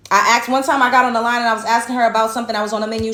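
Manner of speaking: ordinary talk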